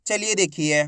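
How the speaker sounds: usual speaking voice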